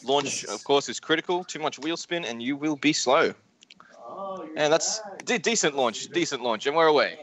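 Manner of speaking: live play-by-play call; race commentary. Acted. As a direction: call the race start as it happens.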